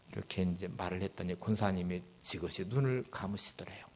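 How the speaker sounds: ordinary talk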